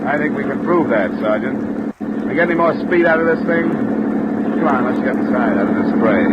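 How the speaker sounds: loud over the engine roar. Acted. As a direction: push the voice over the engine noise. Shouting over boat engine noise.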